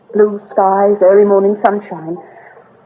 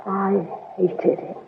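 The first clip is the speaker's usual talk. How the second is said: quiet confession; 11 dB quieter, much slower than usual. Acted. Confession blurted, then apology.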